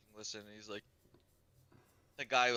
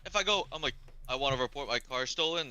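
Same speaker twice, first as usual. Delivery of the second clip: loud, high-pitched arguing; raised voice, acting out frustration; retold exasperation; 6 semitones higher, 6 dB louder than usual. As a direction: argue back at mom, worked up. Acted argument with his mom.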